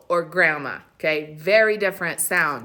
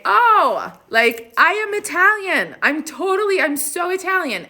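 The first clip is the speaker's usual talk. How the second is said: high, loud gushing imitation; exaggerated, pitched-up mock pride; mocking boaster; 11 semitones higher, 6 dB louder than usual. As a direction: mimic someone bragging with overblown enthusiasm. Mocks people claiming to be Italian.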